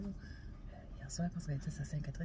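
quick hushed whisper. Real, not acted. Friend whispering gossip to the camera behind mom's back, quick whisper. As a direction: lean in and whisper the gossip fast.

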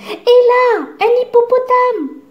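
delighted by everything; marvelling cry. A narrator's reading. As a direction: blackbird marvelling at each sight. Zigomar marvelling again.

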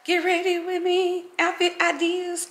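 mock content-creator intro, drawn out and high; vlogger intro impression. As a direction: say it like a perky video title, stretched out. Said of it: "stuff film talking about".

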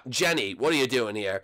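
normal talking voice

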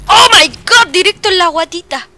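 loud, high exclamation easing slightly; shocked outcry. Real, not acted. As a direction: cry out at a direct hit. Hit in the belly in game.

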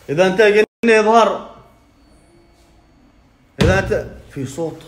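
high, loud yell daring the spirit to appear; loud scared dare; frightened shout. Pretend. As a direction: shout a shaky challenge at the jinn. Challenging a ghost.

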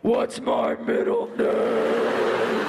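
very slow unvoiced reveal; slow whispered punchline; slow punchline hiss. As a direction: drag the final question out breathily. Punchline of the breakup.

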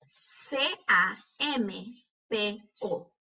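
slow, letter by letter; spelling out. Shown as a demonstration. Teacher spelling campo for pupils, letter by letter. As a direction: leave a gap after each letter. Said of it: "cuando estamos deletreando estas palabras".

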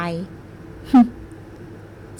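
a breathy teasing chuckle; soft knowing chuckle. Acted. Man amused by the flustered girl, soft knowing chuckle. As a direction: laugh under your breath at her. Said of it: "หัวเราะแบบนั้นหมายความว่ายังไงกัน".

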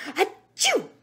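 high, loud achoo; acted sneeze. A narrator's reading. The monkey sneezes at blue feathers, big acted sneeze. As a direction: let a stagy sneeze explode. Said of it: "They make me sneeze".

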